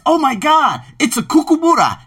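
high-pitched delighted discovery; excited exclamation. Acted. Someone spotting a kookaburra, high excited exclamation. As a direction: exclaim it high, thrilled by the find.

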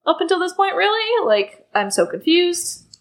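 imitating mild bewilderment, rising high midway; confused. Harry questioning the Minister's careful treatment, mildly confused. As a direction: play a puzzled boy questioning it all. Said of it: "Harry's kind of confused".

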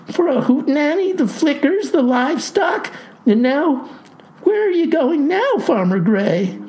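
high-pitched, frantic questioning; distraught cry. A narrator's reading. Clerk demands to know farmer's destination, distraught cry. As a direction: demand answers high, at wits' end. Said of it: "cries she".

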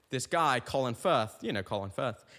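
normal talking voice

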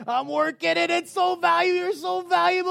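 high cry building to a full shout; impassioned reassurance. Acted. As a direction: build and build until you are shouting the promise. Voices God's insistent reassurance, building.

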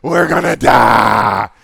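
milk the panic like a drama queen; slow loud melodramatic cry of doom; overly dramatic. Retold or imitated. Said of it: "Are any of you overly dramatic?".

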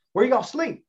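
high-pitched Southern question; Southern accent. Retold or imitated. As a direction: imitate a baffled Southern man. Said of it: "I do the Southern accent".

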